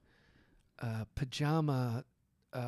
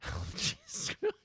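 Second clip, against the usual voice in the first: high, squeaky laugh through the words; laughing disbelief; 13 semitones higher than usual. Real, not acted. Reader breaking off from the prayer in disbelief, laughing high. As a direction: crack up, aghast at what you just read. Cracks up at the poem.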